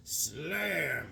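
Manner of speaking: drawn-out, softened slam; crash effect. Retold or imitated. Retelling the crane falling on him, slam as imitated crash.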